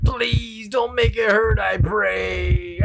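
high, shouting, crying plea; panicked worm. Acted. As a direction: scream and beg in panic.

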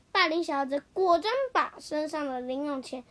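plain reading voice

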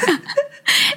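high giggling burst; high laugh. Real, not acted. Laughing at the story of aunties on the metro, high giggle. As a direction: let out a high, amused giggle.

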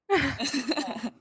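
brief amused laugh; a quick falling chuckle; short laugh. Real, not acted. Laughs at rival's fast growth.

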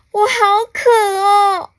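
high, loud, whiny cry; desperate thirsty wail. Acted. Alien before soda fridge is thirsty, desperate wail.

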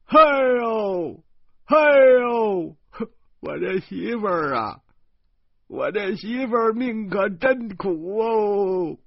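高声哭诉，边哭边说; 抱着哥哥的腰嚎啕哭诉; 哇哇大哭. A narrator's reading. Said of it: "他哇哇大哭". (弟弟抱着哥哥哇哇大哭地哭诉)

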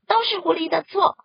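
高而响的群体怒喊; 一群动物一起冲着河马喊; 齐声吼叫. Acted. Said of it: "都生气地大声吼叫". (大声怒吼)

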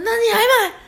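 can't believe she'd still buy it; incredulous. Retold or imitated. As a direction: driver shocked, you still bought it. Driver incredulous at her choice.

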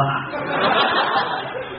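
airless chuckle lifting at the end; a mocking laugh that rises; short chuckle. Brothers tease his swollen face, rising chuckle. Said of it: "哥俩下来乐".

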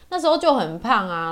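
normal talking voice

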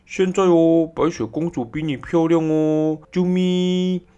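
high, loud cutesy mirror voice; magic mirror. Acted. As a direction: gush flattery in a high loud squeal. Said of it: "魔镜却回答说".

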